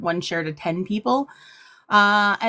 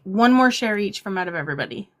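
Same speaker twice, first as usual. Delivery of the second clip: ordinary talk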